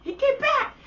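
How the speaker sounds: put-on high, loud baby cheer; voicing the baby. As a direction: give the baby a squeaky triumphant voice. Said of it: "the baby put its hand up".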